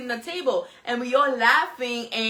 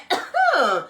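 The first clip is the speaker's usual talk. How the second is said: higher cough, acted out; imitated cough; 4 semitones higher, 3 dB louder than usual. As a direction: replay one sudden cough. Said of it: "I'm like".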